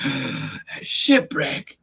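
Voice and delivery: slow breathless whisper of "A shipwreck"; gasp, then breathe "A shipwreck" slowly; awed gasp. Acted. Gasping discovery of shipwreck.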